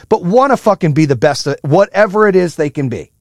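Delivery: ordinary talk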